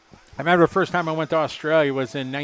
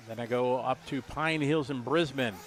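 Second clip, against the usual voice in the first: ordinary talk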